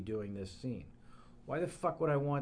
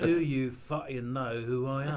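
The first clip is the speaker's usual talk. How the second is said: growled Cockney threat; London hardman menace; Jason Statham impression; 2 semitones higher than usual. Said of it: "how to do Jason Statham".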